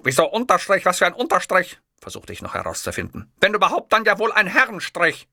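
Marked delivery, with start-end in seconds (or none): 0.0-1.8 higher, louder indignant dictator voice; Hitler lecturing. Acted. Hitler explaining names to the clerk, indignant. 3.4-5.3 higher, louder indignant dictator voice; Hitler lecturing. Acted. Hitler explaining names to the clerk, indignant.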